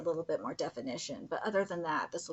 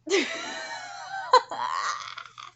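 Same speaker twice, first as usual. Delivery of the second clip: high loud laughing shriek; 6 dB louder than usual. Real, not acted. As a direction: burst out laughing. Laughing at the ogre.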